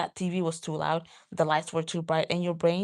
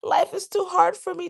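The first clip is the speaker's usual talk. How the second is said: high, louder exaggerated complaint; mock self-pity; 12 semitones higher, 5 dB louder than usual. Imitating her own overwhelmed moment, high mock self-pity. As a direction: whine it up high in mock despair.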